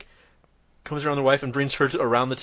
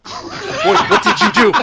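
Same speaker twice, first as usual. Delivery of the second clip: very high, very loud hammed-up yell; mother's angry shriek; 11 dB louder, slower than usual. Mother starting after her boy, shrieking accusation. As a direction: explode in a piercing mock-mother screech.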